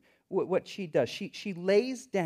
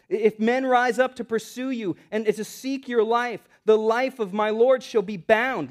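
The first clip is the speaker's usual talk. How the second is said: loud, raised declamation of a verse; preaching scripture; 5 semitones higher, 7 dB louder than usual. Acted. Preacher reads Abigail's speech to David, declaiming. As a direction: proclaim the verse with building force.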